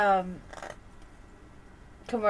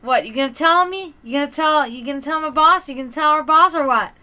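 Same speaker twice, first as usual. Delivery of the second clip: louder mock challenge, are you snitching; daring Ramon; 4 semitones higher, 7 dB louder than usual. To cook Ramon, loud teasing challenge about snitching. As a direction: taunt him loudly. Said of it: "I'm like".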